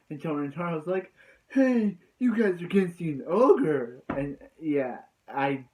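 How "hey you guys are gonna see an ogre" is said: calling out louder in a put-on voice; a touch of a shouted character line; mock excited shout. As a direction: announce it like a hyped-up guide. Rintaro voiced in recap.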